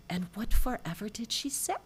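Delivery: conspiratorial whisper in an Irish lilt; whispered question. Acted. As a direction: hush it like a secret at the bar. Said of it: "And the barkeep said".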